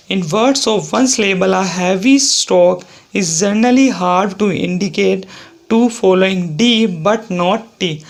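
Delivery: reading the rule out very slowly, word by word; slow dictation reading. Shown as a demonstration. Teacher reading the rule aloud to the class, slow and deliberate. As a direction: dictate it slowly, as if for students taking notes.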